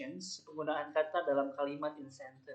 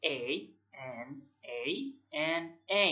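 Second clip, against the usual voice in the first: slow spelling demo; much slower than usual. Shown as a demonstration. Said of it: "spell atau eja".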